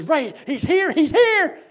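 high, loud, excited call that climbs; rising excited cry of he's here; excited announcement. Retold or imitated. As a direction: burst in breathless with the news. Jim runs up to the door.

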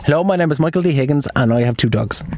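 normal talking voice

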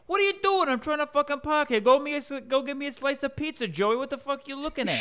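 high, loud, fast New York Italian tirade; New York accent yell. Acted. Irate New York driver yelling at a fat dude in a tracksuit, loud accented rant. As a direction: yell like a tough guy from the Bronx. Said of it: "that you were yelling at".

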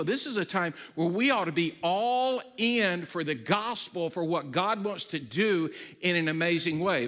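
loud, driving sermon voice, pressing each line home; rally the scattered church with fire; preaching at full force. Acted. Pastor urging the congregation at home to be the church, loud preaching.

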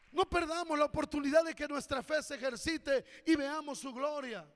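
loud, fervent sermon delivery, lines driven hard; full-force preaching. Acted. Pastor urging his congregation to act in faith, loud fervent preaching. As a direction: preach it out to the whole church.

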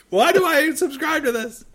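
mock listener. Imitating a fed-up listener, laughing, in a high whiny voice. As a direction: whine it as a fed-up fan through laughter. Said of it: "People are like".

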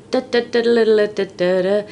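bouncy nonsense babble; playful imitation of an extrovert; mock extrovert chatter. Shown as a demonstration. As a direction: babble like a relentless show-off. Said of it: "apparently quite extrovert and really".

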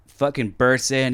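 usual speaking voice

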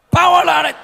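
high, loud barked order; snapping command. Shown as a demonstration. Preacher showing how Jesus should snap the order, loud high shout. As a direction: bark the command sharply. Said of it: "kita ulangi sama-sama".

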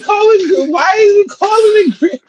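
high-pitched outraged yell; outraged high shout. Real, not acted. Yelling at his co-host over the word gravy, outraged. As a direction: cry out in disbelief at calling it gravy.

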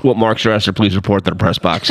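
low stadium announcer voice. Retold or imitated. Announcer summoning someone to the press box, low official voice. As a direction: mimic a deep announcement over the speakers. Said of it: "I hear this".

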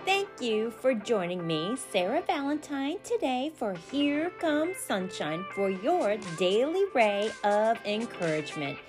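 bright promotional sign-off; upbeat podcast outro. Acted. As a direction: wrap up the show like an ad read. Podcast outro over music.

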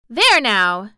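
loud, delighted outburst; exclaiming. A narrator's reading. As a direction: burst out with joy. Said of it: "she exclaimed".

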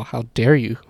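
ordinary delivery

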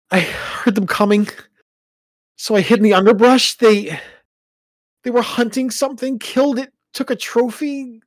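high, loud, anxious recounting; distressed telling. Acted. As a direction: retell the attack, shaken and urgent. Son recounting attack distressed.

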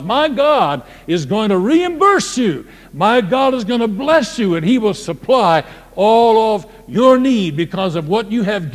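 loud, fervent preaching. Acted. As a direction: build it to a fiery climax. Preaching builds to full force.

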